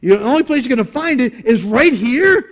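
high, loud, thrilled insistence; excited emphasis. Real, not acted. Preacher telling congregation of the only occurrence, excited. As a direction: drive home how rare the find is.

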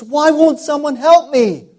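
high, loud, slow plea for help; pleading cry. Retold or imitated. Preacher voicing the prisoner's loud, slow call for help. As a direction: wail it like a desperate prisoner.

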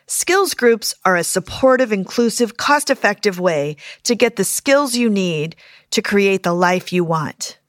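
loud, punchy podcast ad read; promo read. Acted. Therapist plugging her skills groups to podcast listeners, loud promo read. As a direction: pitch it loudly to the listeners.

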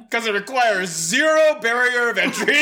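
high, loud question breaking into laughter; cracking up, dragging out the words; laughing disbelief. Real, not acted. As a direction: tease through a big laugh. Teasing host while cracking up.

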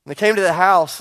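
sermon read with rising force; preaching. Acted. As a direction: preach the story out to the church.

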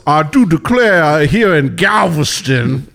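slow, high Southern belle drawl; over-the-top genteel Southern belle. Retold or imitated. Mocking actors playing Southern belles, slow Southern belle accent. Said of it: "an old Southern belle".

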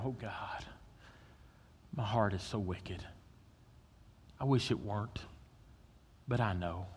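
soft, low confessional prayer; hushed prayer. Retold or imitated. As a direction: murmur it like a private confession. Confessional morning prayer voiced.

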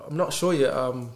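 hesitant, stumbling over false starts; unconfident. Acted. Someone asked what they are doing, answering unconfidently. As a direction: dither between options, losing conviction. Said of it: "that's not confidence".

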